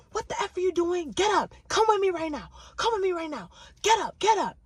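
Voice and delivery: high, loud repeated commands; angry ordering. Retold or imitated. Woman yanking open the car door, loud angry demand. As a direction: order him out like a furious woman.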